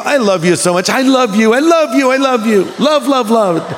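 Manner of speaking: high, hammed-up girlish affection; mock-effusive, high-pitched outpouring; gushing girls. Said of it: "a girl will say to a girl".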